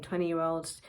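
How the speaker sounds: ordinary delivery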